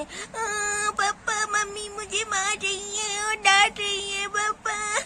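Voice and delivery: put-on high-pitched child's wailing; ज़ोर से रोना. A narrator's reading. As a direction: cry out to papa in a high, drawn-out wail, sobs at the end. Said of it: "ज़ोर-ज़ोर से रोने लगती है". (बच्ची का ऊँची आवाज़ में रोना)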